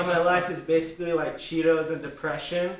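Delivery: normal talking voice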